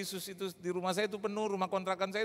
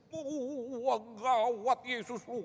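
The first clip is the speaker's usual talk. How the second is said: amazed exclaiming; 5 semitones higher, much slower than usual. Retold or imitated. Friend amazed by healing.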